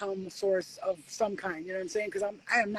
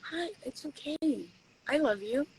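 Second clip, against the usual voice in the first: higher, softer gentle reassurance; tender comforting; 5 semitones higher, 5 dB quieter than usual. Pretend. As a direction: soothe a frightened monster. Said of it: "and you go".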